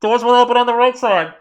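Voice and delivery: high, loud train PA voice; subway announcer. Retold or imitated. Mock Blue Line announcement, loud tinny PA. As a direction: blast it over the subway speakers. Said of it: "train announcement".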